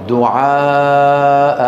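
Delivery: دعاء ending in a long, slowly held alif; long madd al-iwad. Shown as a demonstration. Teacher showing how to pause on it, long drawn vowel. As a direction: stretch the last vowel of دعاء. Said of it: "كيف نقف عليها؟".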